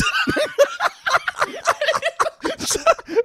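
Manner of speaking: high burst of laughter. Real, not acted. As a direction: crack up laughing. Laughing at own story.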